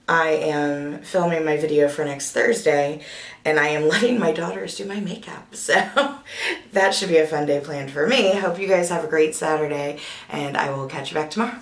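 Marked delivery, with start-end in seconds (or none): 5.8-6.7 breathy laugh. Real, not acted. Laughs about daughters doing makeup.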